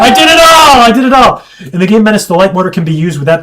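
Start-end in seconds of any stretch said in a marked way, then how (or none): 0.0-1.4 loud, high victory yell; triumphant shout. Real, not acted. Gamer crowing to stream over a win, shouting. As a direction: crow about winning the whole thing. Celebrating win after chat message.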